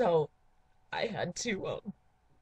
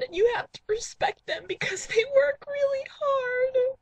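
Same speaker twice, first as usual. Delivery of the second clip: higher and louder, crying through it; mock tearful wail; 10 semitones higher, 6 dB louder than usual. Host telling dates to respect U2, crying wail. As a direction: sob out your admiration.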